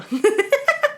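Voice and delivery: squeaky high giggle; high laugh. Real, not acted. Laughing at chat.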